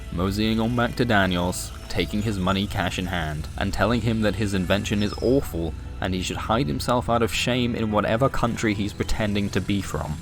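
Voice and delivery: plain reading voice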